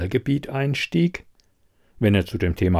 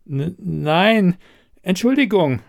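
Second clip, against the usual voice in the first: higher, flustered stammer; 8 semitones higher than usual. A narrator's reading. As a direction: stumble over the first word, then apologise. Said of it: "stotterte sie".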